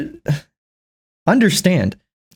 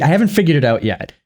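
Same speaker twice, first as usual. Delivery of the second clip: usual speaking voice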